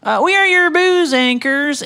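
high, loud host announcement; announcer voice. Acted. Podcast intro announcing.

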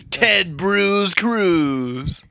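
drawn out. Pretend. Drawn-out pun name.

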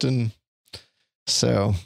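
usual speaking voice

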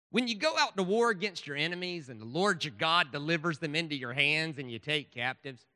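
loud Southern preacher's reading; Southern accent. Acted. Speaker reads Deuteronomy to the audience, in a loud Southern accent. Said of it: "read this is in a Southern accent".

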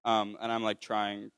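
ordinary talk, nothing marked

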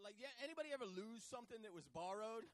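ordinary talk

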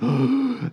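big mock gasp of amazement; awestruck gasp. Imitating son at his first donut, awestruck gasp. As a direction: gasp like a kid tasting heaven.

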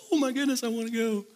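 high, excited imitation of the sister-in-law; excited gush. Pastor imitating his wife wanting the trip, high and excited. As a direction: squeal it like you're dying to go. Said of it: "And Chris was like".

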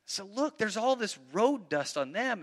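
normal talking voice

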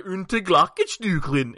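slow, high mock German gibberish; hammed-up fake German words. Shown as a demonstration. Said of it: "like, fake German".

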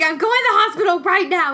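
urgent. Retold or imitated. Urgent call to husband.

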